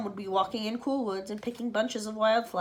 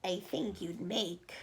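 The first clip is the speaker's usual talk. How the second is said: sly setup; 3 semitones lower, 8 dB quieter, slower than usual. Acted. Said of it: "Aunt Spiker said".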